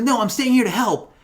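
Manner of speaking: high-pitched mock kid protest; defiant kid. Retelling the kid refusing to leave, high and insistent. Voicing kid defying the hero.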